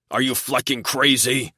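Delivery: loud enraged roar at his brother. A narrator's reading. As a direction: bellow at the brother in rage. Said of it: "I roared".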